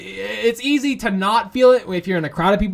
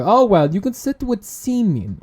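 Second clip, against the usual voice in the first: ordinary talk, nothing marked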